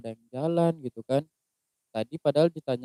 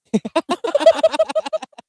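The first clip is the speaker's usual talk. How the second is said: loud, very high squealing laugh; helpless high cackle; real burst of laughter; 8 dB louder than usual. Real, not acted. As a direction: crack up in a long high-pitched peal. Laughing at the retold ending.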